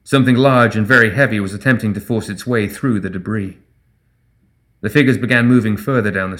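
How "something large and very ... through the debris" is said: ordinary delivery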